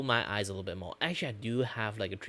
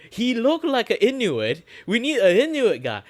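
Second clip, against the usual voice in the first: high, loud sarcastic yell; mocking yell, pitched way up; mock casting shout; 14 semitones higher, 13 dB louder than usual. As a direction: shout it like a ridiculous casting director. Mocking racist casting logic, escalating.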